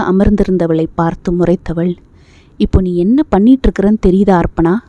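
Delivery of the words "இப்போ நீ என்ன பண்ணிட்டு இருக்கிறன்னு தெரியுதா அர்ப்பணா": ordinary delivery, nothing marked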